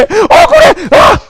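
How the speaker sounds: high, protesting yell; acted aggrieved shout. Teacher acting out feeling wronged, high aggrieved shout. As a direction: cry out as if unfairly treated. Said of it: "억울할 때".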